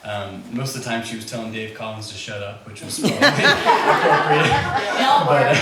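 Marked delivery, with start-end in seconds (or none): 2.7-5.5 much higher and louder, slowed by laughter; laughing. Real, not acted. Bandleader recalling Mary's teasing, bursting into laughter. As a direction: laugh right through the words.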